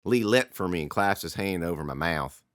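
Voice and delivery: ordinary delivery, nothing marked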